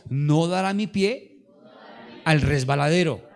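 slow, loud line for the church to repeat; call and response. Shown as a demonstration. Pastor leading the church in repeating the verse, slow and loud. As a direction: lead the congregation through it word by word. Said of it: "Diga conmigo".

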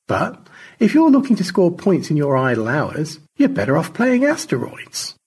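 high, mock-conciliatory teasing; arch, high-pitched mockery; needling crewmate George. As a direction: tease him with swooping, exaggerated sweetness.

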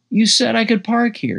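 high, loud outraged complaint; angry. Acted. Keith blames the narrator for the tow, angry. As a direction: blame him hard.

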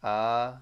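A sounded firmly as a chant vowel; sound demonstration. Shown as a demonstration. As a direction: hold A as an open "aah". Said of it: "collective of three sounds".

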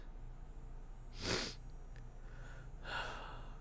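heavy unvoiced sigh; long sigh. Real, not acted. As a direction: breathe out slowly to steady yourself. Trying not to cry.

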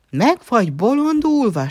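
high, loud outraged shriek. A narrator's reading. Nana finds her nose turned to a trunk, shrieking. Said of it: "sikította Nana".

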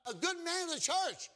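full-force preaching. Acted. Preacher tells the congregation what Nicodemus lacked, full-force preaching.

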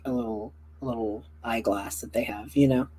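usual speaking voice

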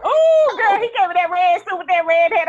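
high, loud outburst at an outfit; loud exclaiming. Retold or imitated. Telling a friend about the red suit, loud scandalized exclaiming.

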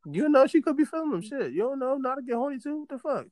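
high, animated mock defense; high-pitched playful pleading; excited teasing. Real, not acted.

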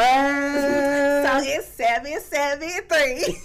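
slow, words pushed through laughter; giggling out the number while cracking up; laughing. Real, not acted. Teasing a friend about a New York pickup line, laughing.

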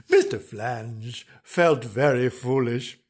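ordinary delivery, nothing marked

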